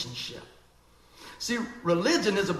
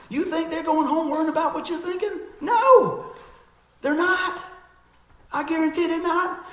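much higher and louder, insistent; emphatic preaching; 11 semitones higher, 8 dB louder than usual. Real, not acted. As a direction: hammer it home from the pulpit. Preacher building to emphatic point.

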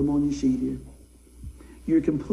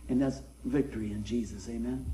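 usual speaking voice

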